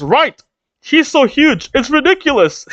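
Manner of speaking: high, gleeful acted wonder; excited amazement. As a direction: marvel at it in a high voice. Acting Luffy's amazed reaction.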